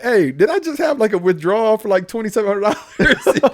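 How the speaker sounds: high, incredulous question to the teller; retold alarm. Asking the bank teller about a withdrawal, high and incredulous.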